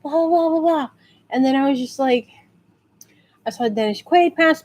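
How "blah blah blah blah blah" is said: mock starstruck. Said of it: "I was super into, like".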